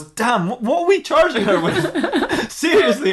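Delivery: very high, incredulous mock outburst; exasperated disbelief. Detective challenging his officers over the charge, exasperated. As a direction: play an exasperated boss in a high, laughing voice.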